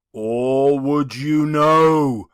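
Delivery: slow, heavy spectral voice; solemn ghost. Acted. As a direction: weigh each word like a chain. The ghost speaks.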